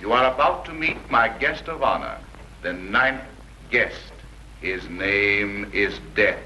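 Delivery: sinister radio host. Acted. Radio host announces the ninth guest.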